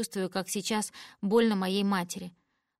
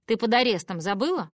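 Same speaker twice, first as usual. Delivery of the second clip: scolding; 2 semitones higher, 6 dB louder than usual. Acted. Father scolding daughter.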